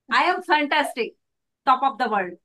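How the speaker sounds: upbeat, happy self-assured declaration; positive, energetic. Shown as a demonstration. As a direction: announce it with energy and confidence. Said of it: "positivity. ... Energy. ... Happiness".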